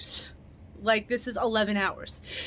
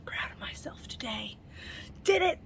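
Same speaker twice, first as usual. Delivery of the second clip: hushed whisper of pride; whispered self-praise; 4 semitones higher, 7 dB quieter than usual. Retold or imitated. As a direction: whisper it to yourself, pleased. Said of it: "I was like".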